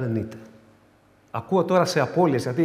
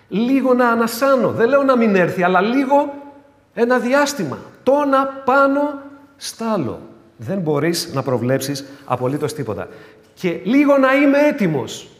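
a hint of a worn-out believer begging for rest; tired man asking for a pause; pleading believer; 8 semitones higher, 5 dB louder than usual. Preacher voicing a weary believer.

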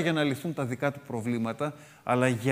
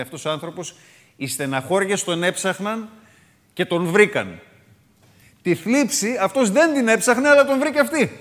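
starts level, climbs much higher and louder to the end; building amazement; 6 semitones higher, 6 dB louder than usual. Retold or imitated. A reader marvelling at the verse, rising to loud amazement. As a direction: let the wonder grow line by line until it rings out. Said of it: "Λέει".

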